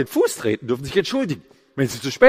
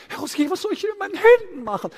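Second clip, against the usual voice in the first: much higher, put-on voice of a helpless trainee; ratloser Seminarteilnehmer; 12 semitones higher than usual. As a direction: imitate a trainee pleading for advice. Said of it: "die machen Rhetoriktraining und sagen". (ratlose, hohe nachgeahmte Stimme)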